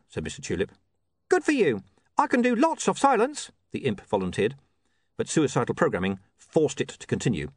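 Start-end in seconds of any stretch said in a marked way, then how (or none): 1.3-3.5 high-pitched imp volunteering; small imp offering help; eager imp. Acted. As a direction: pipe up high, keen to please.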